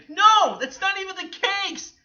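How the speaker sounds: high, loud outburst; indignant yell; emphatic refusal. Real, not acted. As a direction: cut in with a shouted rebuttal. Rejects the imitated idea.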